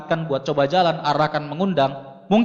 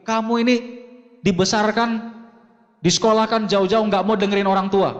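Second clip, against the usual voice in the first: higher-pitched scolding mother; angry mother scolding; 5 semitones higher than usual. Retold or imitated. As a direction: scold the stubborn son who won't listen. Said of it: "ikut ngomong dan ikut marah".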